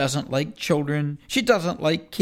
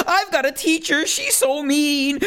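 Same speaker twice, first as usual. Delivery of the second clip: higher complaint; 8 semitones higher than usual. Acted. Pupil griping about his teacher, much higher. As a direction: whine it to the class. Title line repeated.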